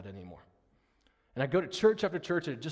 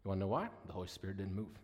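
ordinary delivery

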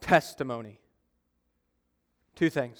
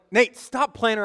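scolding; 6 semitones higher than usual. Retold or imitated. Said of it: "I was like".

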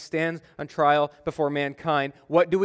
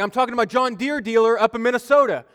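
insistent; 7 semitones higher, 4 dB louder than usual. Retold or imitated. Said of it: "He's like".

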